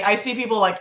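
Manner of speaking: ordinary talk, nothing marked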